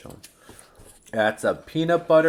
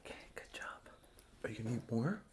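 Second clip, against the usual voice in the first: soft whispered praise; whispering; 5 semitones lower, 19 dB quieter, much faster than usual. Real, not acted. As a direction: whisper it close. Parent whispers to child.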